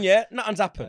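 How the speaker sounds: ordinary talk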